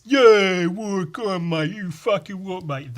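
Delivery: high, brash mock lad. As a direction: sneer it like a laddish bloke. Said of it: "the exact sort of person that is".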